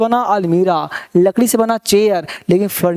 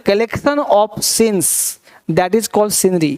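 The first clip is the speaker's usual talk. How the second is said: slow dictation; much slower than usual. Shown as a demonstration. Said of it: "teacher dictating definition".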